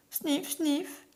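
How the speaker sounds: reniflements aigus de sanglot; sanglots reniflés. A narrator's reading. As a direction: renifler comme un enfant qui pleure. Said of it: "sanglote Minilou". (sanglot joué en reniflant)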